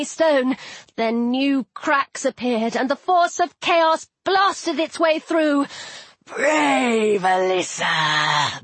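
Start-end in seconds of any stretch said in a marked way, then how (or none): 6.3-8.6 very slow, low serpent voice; mocking serpent. A narrator's reading. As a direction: draw the name out mockingly, down low. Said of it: "the serpent said".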